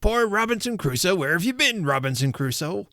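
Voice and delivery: high squawky parrot imitation. A narrator's reading. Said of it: "It was the parrot".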